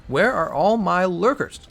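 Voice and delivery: slow, loud, drawn-out summons; loud call-out. Real, not acted. Streamer calling out silent viewers, slow and loud. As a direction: bellow it slowly to the whole chat.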